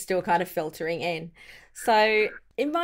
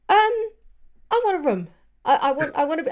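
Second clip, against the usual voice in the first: put-on higher, stammering young lord asking for a room; lightly voiced nervous young man; flustered Arland; 8 semitones higher, 3 dB louder, much faster than usual. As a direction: fumble it like a shy teenage boy.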